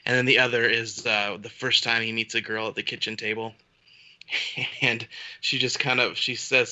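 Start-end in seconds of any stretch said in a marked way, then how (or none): none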